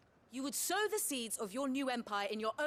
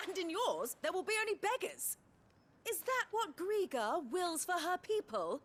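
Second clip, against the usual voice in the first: higher-pitched, impassioned challenge; pointed, raised-pitch rebuke; heated retort; 5 semitones higher than usual. Acted. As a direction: throw it back as an accusation. Heated argument with the duke.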